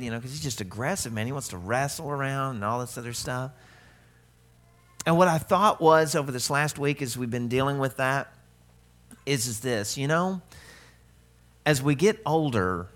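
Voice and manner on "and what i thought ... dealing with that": ordinary talk, nothing marked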